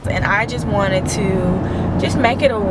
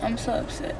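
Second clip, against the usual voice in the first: much softer, fragile and close to crying; on the verge of tears; 2 semitones higher, 16 dB quieter than usual. Real, not acted. Alone after bad news, near tears, talking softly to viewers. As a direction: hold back the tears, barely speaking. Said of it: "I feel like I'm gonna cry".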